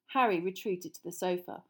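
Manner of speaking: usual speaking voice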